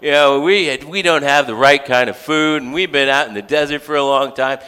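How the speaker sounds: high, whiny grumble, slowed down at first; mock complaint of grumbling people; whiny complaining Israelites. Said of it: "they're like".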